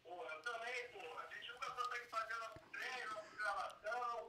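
on speakerphone. Real, not acted. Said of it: "Deixa eu pôr aqui no Viva Voz".

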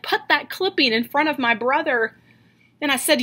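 normal talking voice

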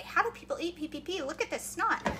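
mock complaint. Imitating a reader complaining on the group page, mock complaint. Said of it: "you're gonna complain about it on the Trim Healthy Mama page and say".

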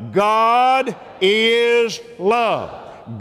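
very slow, stretched God is love; drawn out. Shown as a demonstration. The preacher has the church say it out loud, very slowly. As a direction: lean into each word slowly. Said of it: "Say it out loud".

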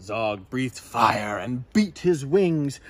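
usual speaking voice

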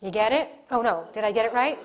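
ordinary talk, nothing marked

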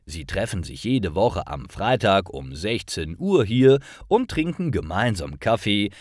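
normal talking voice

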